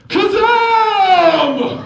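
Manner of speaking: huge, high, drawn-out shout; climaxing magician. Acted. Magician having an orgasm, yelling his magic word. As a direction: cry out the magic word ecstatically. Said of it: "this is my impression of a magician having an orgasm".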